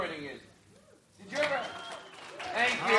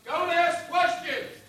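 mock stern order; 9 semitones higher, 5 dB louder, much slower than usual. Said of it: "So act like you're me".

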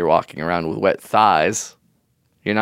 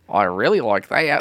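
put-on terrible New Zealand accent. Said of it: "That's a terrible New Zealand accent".

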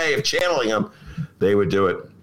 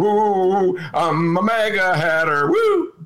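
mock cheer; 6 semitones higher, slower than usual.

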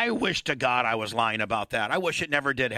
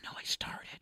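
whisper; 13 dB quieter than usual. Retold or imitated. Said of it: "She's like".

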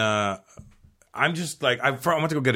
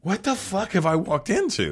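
higher, slow, awestruck; stunned disbelief; 10 semitones higher than usual. Retold or imitated. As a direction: marvel at the unreal scene. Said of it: "I'm like".

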